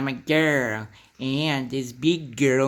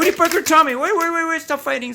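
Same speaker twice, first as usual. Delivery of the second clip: high loud urgent yelps; loud slapstick yelps; 6 dB louder, faster than usual. Pretend. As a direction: yelp to stop the fight. Breaking up fight.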